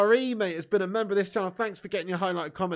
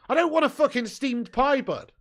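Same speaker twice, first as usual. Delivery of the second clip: mock outrage; 5 semitones higher, 4 dB louder than usual. Real, not acted. Mock outrage at pudding suggestion.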